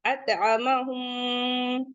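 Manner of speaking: अतअमहुम very slow with the nasal मम held; slow recitation with a held nasal hum; with ghunna. Shown as a demonstration. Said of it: "गुन्ना के साथ पढ़ते हैं ना".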